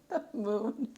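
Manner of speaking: high laughing panic over naked cards; flustered laughing. Real, not acted. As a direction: squeal it out, amused and flustered. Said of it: "it's giving me a little bit of anxiety".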